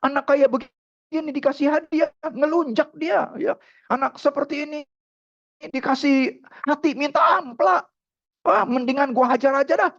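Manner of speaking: high-pitched scornful father; indignant refusal. Retold or imitated. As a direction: scoff at rewarding such a child.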